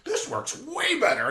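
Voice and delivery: high, slow, delighted mock voice; pleased grandfather. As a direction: savour how well it works. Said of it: "my grandfather's like".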